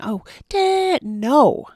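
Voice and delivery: high, loud mock shriek at a parent; mock screaming. Podcaster imitating yelling at her dad, high mock shriek. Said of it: "does not look like yelling and screaming".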